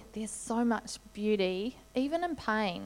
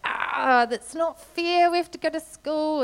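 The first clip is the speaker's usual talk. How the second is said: put-on whiny kids; 7 semitones higher, 5 dB louder than usual. Kids gutted, no snow.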